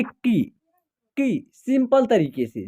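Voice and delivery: की said simply with a short, quick i; short vowel. Shown as a demonstration. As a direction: say की briefly, without drawing out the vowel. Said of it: "जब simple तरीके से करेंगे".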